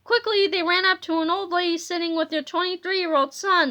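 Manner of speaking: plain reading voice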